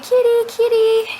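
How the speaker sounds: sing-song high call for a kitten; high kitty call. Retold or imitated. Calling a howling cat in the snow, high sing-song.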